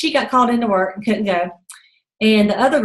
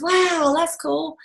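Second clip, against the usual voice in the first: impressed; 6 semitones higher, much slower than usual. Retold or imitated. Retold girl impressed by prize.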